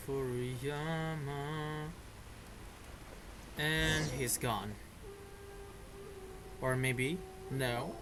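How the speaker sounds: normal talking voice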